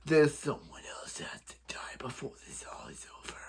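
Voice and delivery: hushed mock-villain whisper; Slade. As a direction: whisper it ominously, almost all breath. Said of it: "then Slade's like".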